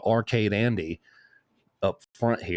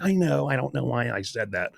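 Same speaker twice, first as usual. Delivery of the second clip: higher-pitched girlfriend imitation; mock regretful whine; 4 semitones higher than usual. Girlfriend admitting she didn't want the fries, mock regret. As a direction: mimic a helpless admission, pitched up.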